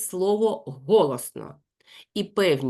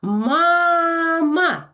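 loud, high «Мама!»; loud call; 10 semitones higher, 7 dB louder than usual. Shown as a demonstration. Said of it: "я знову голосно кажу". (голосно, високо «Мама!»)

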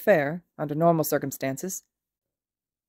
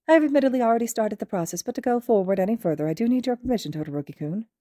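higher, polite small-principal voice; a courteous, gently cheerful headmaster; Nezu's voice; 4 semitones higher than usual. Acted. Principal welcoming a student, higher and polite. Nezu asks permission.

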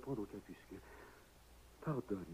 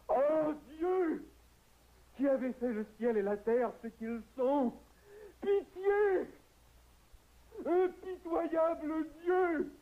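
much higher and louder, pleading to heaven; anguished outcry; 13 semitones higher, 3 dB louder than usual. Acted. Grieving husband cries out to God for pity. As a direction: rage and beg at God.

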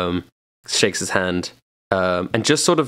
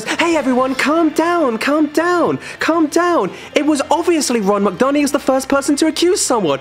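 much higher, insistent calm-down plea; calming mediator. Acted. Joy trying to calm the auction crowd, insistent. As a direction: try to settle the room, then accuse. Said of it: "Joy gets up and goes".

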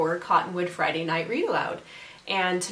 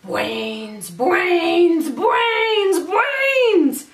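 long drawn-out wail, climbing much higher and louder; zombie moan; 9 semitones higher, 6 dB louder, much slower than usual.

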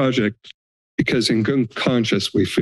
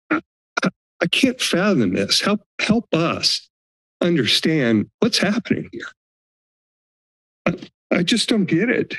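pleading, pitch climbing higher; distressed plea; 7 semitones higher than usual. Real, not acted. Homeowner pleading with supervisors, anguished and higher. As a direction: beg them to help you understand.

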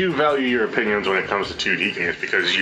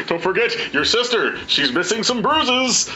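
1950s father voice; 13 semitones higher than usual. Pretend. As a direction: keep up the wholesome fifties dad. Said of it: "old nineteen fifties father voice".

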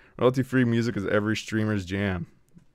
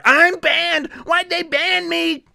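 very high, whiny mocking voice; loud high mock wail; mocking whiner; 3 dB louder, much slower than usual. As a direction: mimic a complainer in a squeaky wail. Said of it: "Especially you, Mister".

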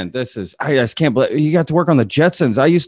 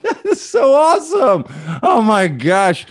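thrilled; 8 semitones higher than usual. Real, not acted. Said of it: "host thrilled by cockatoo".

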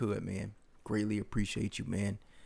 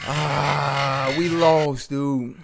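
loud, higher cries of dismay; 4 semitones higher, 7 dB louder, slower than usual. Real, not acted. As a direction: yell as the last life is lost. Losing the last life.

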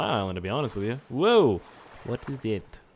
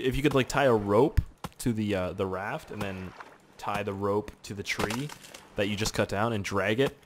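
usual speaking voice

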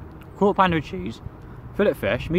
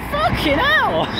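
shocked; much slower than usual. Real, not acted. Watching car sink, then laughs.

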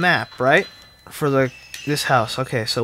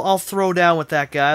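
ordinary talk

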